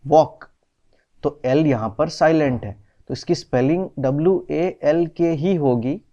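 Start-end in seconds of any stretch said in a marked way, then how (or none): 0.1-0.4 वॉक as "wawk", louder, without L; silent L. Shown as a demonstration. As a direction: pronounce वॉक firmly, no L. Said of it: "एल में कोई sound नहीं है". (वॉक, ज़ोर से, L silent)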